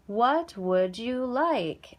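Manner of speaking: each word drawn out for the class; slow model phrase; teacher modeling the question slowly for students; slow, clear teacher model. Shown as a demonstration. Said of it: "so I would say".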